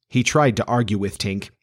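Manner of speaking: usual speaking voice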